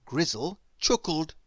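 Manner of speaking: plain reading voice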